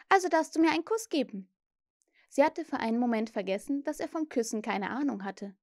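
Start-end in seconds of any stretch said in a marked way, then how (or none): 0.1-1.5 put-on higher, quicker girl's voice, giving in; conceding. A narrator's reading. Wendy grants Peter a kiss, conceding. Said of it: "sagte sie nachgiebig".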